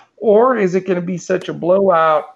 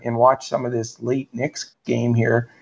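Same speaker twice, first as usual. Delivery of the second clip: ordinary talk, nothing marked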